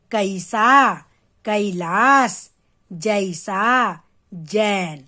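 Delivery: "कैसा, कैलाश, जैसा, जैन" slow, the ai opened into "a-ee"; open, spread ai. Shown as a demonstration. Teacher showing children how to open the mouth for "कैसा, कैलाश, जैसा, जैन", slow spread ai. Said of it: "फैला के बोलेंगे".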